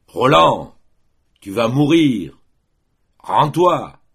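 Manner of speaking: loud, high battle cry; shouted threat. A narrator's reading. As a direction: call out a demand to surrender. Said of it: "criait le mort". (cri de menace, fort et aigu)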